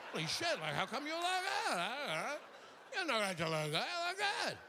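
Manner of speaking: mock astonishment swooping into a high squeal; hammed-up disbelief, voice shooting way up; incredulous surprise. Comic mimics people amazed at his looks, hammed-up squeaky surprise. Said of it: "they say it with a kind of surprise".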